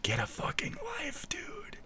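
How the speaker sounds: mock muttering that sinks into a whisper; mocking imitation of a viewer. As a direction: play the sneering watcher, dropping to a whisper. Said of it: "while going".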